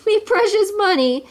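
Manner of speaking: high storybook wail of loss; despairing miser's cry; anguished cry. A narrator's reading. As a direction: lament it high, hammed up. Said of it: "cried Scrooge".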